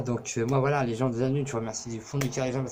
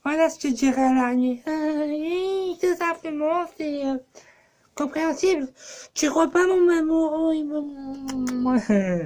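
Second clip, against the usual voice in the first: very high squeaky mock girl voice; high, simpering fake-girl pitch; 6 dB louder, slower than usual. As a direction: put on an exaggerated falsetto girly voice. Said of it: "un peu la voix de fille".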